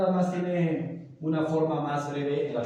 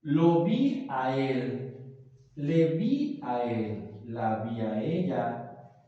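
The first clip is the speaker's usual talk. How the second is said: slow, deliberate example phrases; slower than usual. Shown as a demonstration. Teacher demonstrates pronoun use, slowly. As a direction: lay each word out for students. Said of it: "puede estar expresado en la palabra".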